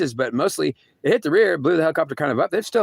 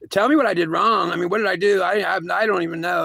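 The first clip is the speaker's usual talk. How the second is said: higher, pleading cry at God; yelling at God; 6 semitones higher than usual. Retold or imitated. A dying man yelling at God, higher and pleading. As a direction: raise the pitch and demand answers from God. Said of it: "I was trying to yell at God".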